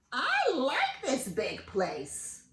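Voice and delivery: slow, satisfied bird wife voice; smug wife bird; pleased Missus Bird. Acted. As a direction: savour the big place. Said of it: "said Missus Bird".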